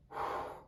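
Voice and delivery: re-enacted heavy sigh of relief; soft, airy blown-out breath; relieved exhale. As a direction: breathe out long after a narrow escape. Retold relief on getting inside taxi.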